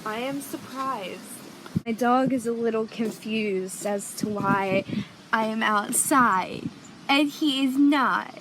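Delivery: normal talking voice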